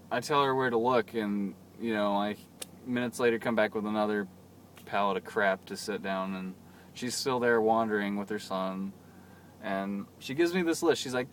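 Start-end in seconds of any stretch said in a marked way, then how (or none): none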